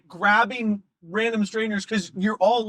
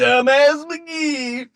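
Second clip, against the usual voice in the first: slow, loud, high Southern shout of the name; Southern accent shout; 5 semitones higher, 6 dB louder, much slower than usual. Retold or imitated. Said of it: "he says it in a Southern accent".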